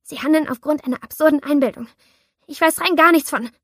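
high, loud frightened protest; panicked pleading objection; shaky voice. Acted. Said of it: "meine Stimme zitterte".

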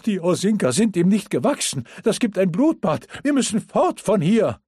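high, loud, breathless and panicked. Acted. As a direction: gasp it out, fast, in panic. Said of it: "keuchte er atemlos".

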